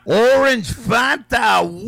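usual speaking voice

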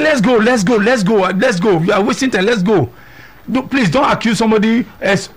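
high, pressing voice urging to leave; urgent husband. Retold or imitated. Husband urging in retold quarrel.

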